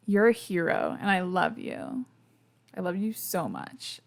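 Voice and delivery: usual speaking voice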